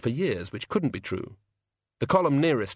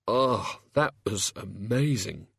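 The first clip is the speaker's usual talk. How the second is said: weak; slower than usual. A narrator's reading. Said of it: "he managed".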